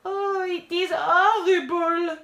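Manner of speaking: put-on high, slow French-accented wail; drawn-out, tearful theatrical lament; sobbing diva. A narrator's reading. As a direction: sob it melodramatically as a French diva. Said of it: "sobs Eva".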